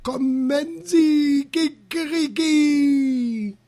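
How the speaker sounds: high, drawn-out crowing call; ohrenbetäubendes Geschrei. A narrator's reading. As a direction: crow it out slowly like a showy rooster. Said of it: "ein ohrenbetäubendes Geschrei".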